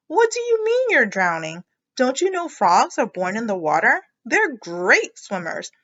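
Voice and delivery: mother scolding Froggy; incredulous mother. Acted. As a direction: scold him in disbelief.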